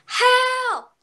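high, loud scream for help. A narrator's reading. Goldilocks wakes to see the bears, loud high scream. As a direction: shriek "Help!" in sudden fright. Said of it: "She screamed".

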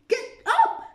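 very high, very loud bellow; queen's shout. A narrator's reading. Queen shouts at the gardeners to stand. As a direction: yell at the gardeners. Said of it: "the queen shouted".

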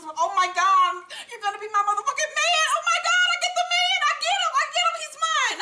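high, shouting mock glee; ecstatic. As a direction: squeal it like she finally won the man. Angela ecstatic over separation papers.

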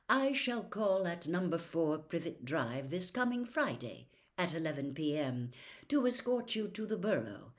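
plain reading voice

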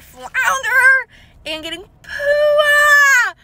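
high, loud, drawn-out squeal; real excitement. Real, not acted. Collector tells viewers which two she got, excited squeal. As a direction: squeal both names in delight. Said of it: "I'm so excited".

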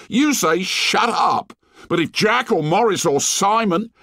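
put-on high, bitter complaining boy; bitter whine. A narrator's reading. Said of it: "cried Piggy with bitter realism".

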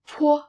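"po" with a strong puff of air on the p; breathy aspirated "po". Shown as a demonstration. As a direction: blow air out on "po". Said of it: "the aspirated sound".

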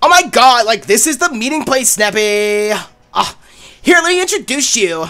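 drawled-out chatty coffee-shop type; Starbucks voice. Acted. Player voices Serena introducing a friend, Starbucks voice. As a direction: stretch the line endings in character. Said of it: "give Serena the Starbucks voice".

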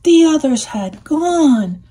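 ordinary delivery, nothing marked

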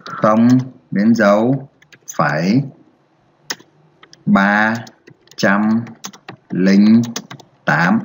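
word by word, long pauses between; spaced-out reading for pupils to follow; slow dictation. Shown as a demonstration. Teacher models reading the decimal to the class, slow and spaced. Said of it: "Đọc là".

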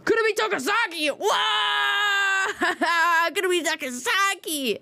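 squealing high-pitched fangirl shout; excited anime fan. Real, not acted. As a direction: shriek her name with delight. Squealing over favorite anime girl.

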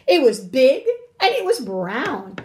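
emphatic, mildly alarmed telling; alarmed cry. A narrator's reading. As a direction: report it as a worried mouse. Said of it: "cried the mouse".

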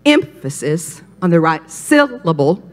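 EMphasis and SYLlable, stressed on the first syllable; deliberate, spaced "emphasis" and "syllable", first syllables hit; right-syllable stress. Shown as a demonstration. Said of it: "if I put the accent".

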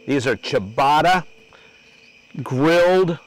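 slow, deliberate announcer reveal; drawn-out showman's billing of the food; dish presentation announcement. Real, not acted. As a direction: announce the dish piece by piece with pauses.

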